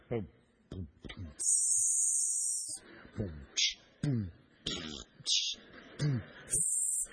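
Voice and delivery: low, hushed beatbox booms and hissing tss; mock bubbling booms. At the compost barrel with his son, a low mimicked boom and tss beat. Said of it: "it was just like".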